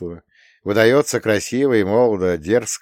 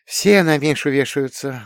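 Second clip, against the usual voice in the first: proud smile; 6 semitones higher, 4 dB louder than usual. A narrator's reading. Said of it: "гордо улыбнулась мама".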